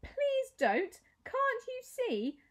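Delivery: high pleading voice, slow at the end. A narrator's reading. Maya begging the twins to stop, high and pleading. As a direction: beg them to stop.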